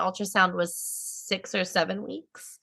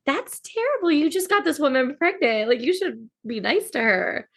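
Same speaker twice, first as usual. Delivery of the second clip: appalled scolding; 5 semitones higher than usual. Retold or imitated. Acting own appalled reaction to character.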